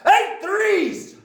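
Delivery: very high, very loud bellow; shouting Trunchbull. A narrator's reading. Headmistress shouting at dangling Wilfred, high furious bellow. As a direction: blast the sum out. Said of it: "the Trunchbull shouted".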